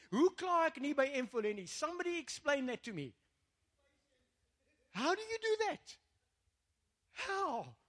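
high-pitched incredulous demand; exasperated challenge. Real, not acted. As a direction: demand an answer from the congregation.

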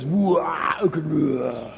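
grunting like a movie tough guy; a preacher lightly mocking movie heroes; mock action hero. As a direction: ham up a burly hero's battle grunts. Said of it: "The hero's got to be this".

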